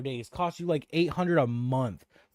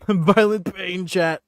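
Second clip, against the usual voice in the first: slow, louder through a laugh; 5 semitones higher, 5 dB louder, slower than usual. Real, not acted. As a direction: laugh it out slowly.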